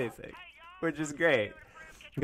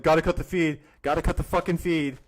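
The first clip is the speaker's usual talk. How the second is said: louder put-on voice of a panicky host; mocking imitation; 5 semitones higher, 7 dB louder than usual. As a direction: parrot the host cutting him off. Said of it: "Nicholas Diorio's like".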